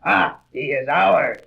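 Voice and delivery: slow, loud triumphant gloat. Acted. Alien gloating as Thor falls into the cage. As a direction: savour the capture.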